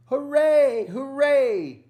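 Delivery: high, loud joyful cheer; cheering shout. Acted. Pumpkins cheering their hero, loud high cheer. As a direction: shout it like a crowd of pumpkins celebrating. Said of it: "All the pumpkins shouted".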